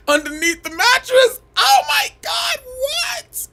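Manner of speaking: very high laughing shriek; squealing laughter. Real, not acted. Cracking up at the joke.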